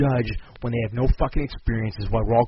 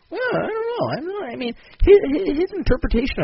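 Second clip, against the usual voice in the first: mock waffling judge; 13 semitones higher than usual.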